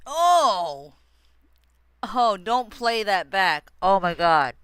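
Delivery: loud shocked outburst; startled exclaim. Real, not acted. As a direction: yelp it out, caught off guard. Shocked reaction just after the sneeze.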